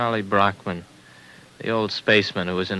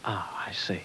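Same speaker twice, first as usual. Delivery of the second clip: breathy whispered aside, much softer; 2 semitones lower, 9 dB quieter than usual. Acted. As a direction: mutter it under your breath in dawning realization. Reaction on learning he's drugged.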